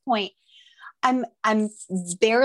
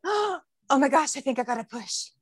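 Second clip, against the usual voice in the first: mock startled urgency after a gasp; sudden alarm; 3 semitones higher than usual. Retold sudden urge to push.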